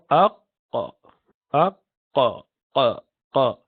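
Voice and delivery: showing the qaf articulation; qaf demo. Shown as a demonstration. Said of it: "you pronounce letter qaf".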